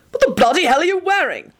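high, barked angry question; barking. Acted. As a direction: bark it out in disgust. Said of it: "Arthur barked out".

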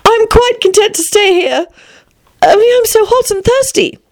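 put-on very high, girlish voice; pleading Alice. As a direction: plead politely in a little-girl voice.

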